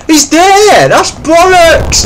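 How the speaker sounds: very high, loud, slow indignant yell; drawn-out high furious protest; outraged shout. Real, not acted. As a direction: bellow it in disbelief. Spots opponent, outraged.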